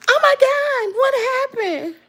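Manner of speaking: high-pitched imitation of a shocked woman; mock-shocked dog owner. Dog owner running out to the injured carrier, mock-shocked.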